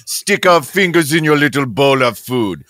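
lightly put-on French accent; French judge voice, low. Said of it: "does it sound like I have a French accent".

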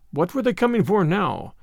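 usual speaking voice

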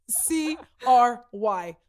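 slow, emphatic letter by letter; spelled out. Real, not acted. Joking that she will cry later, spelled out. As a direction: spell it slowly for comic emphasis.

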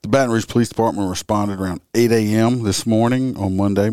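normal talking voice

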